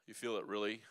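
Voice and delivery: normal talking voice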